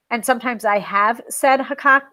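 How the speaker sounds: usual speaking voice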